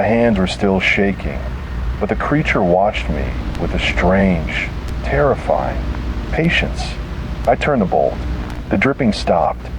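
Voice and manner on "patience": ordinary delivery, nothing marked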